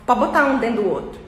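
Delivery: "pode botar um dentro do outro" rápido e emendado; fast natural speech. Shown as a demonstration. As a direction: fale "pode botar um dentro do outro" corrido, como nativo. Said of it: "Você entendeu, porque você é fluente". (fala rápida e emendada)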